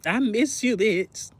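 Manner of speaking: a gentle hint of missing a friend; quiet longing. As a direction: say it soft, barely there. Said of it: "I'm like".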